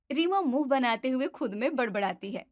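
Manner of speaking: ordinary delivery, nothing marked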